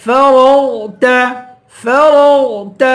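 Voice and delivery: فَرَغْتَ with heavy ر and غ; full-mouthed letters. Shown as a demonstration. Teacher showing heavy letters, فَرَغْتَ with full رَغْ. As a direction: chant فَرَغْتَ, thickening the middle. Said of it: "دونوں کو پر پڑھیں گے".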